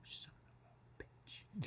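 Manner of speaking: whispered, slow curse; mouthed curse. Retold or imitated. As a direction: mouth it silently across the room. Boss mouths curse from afar.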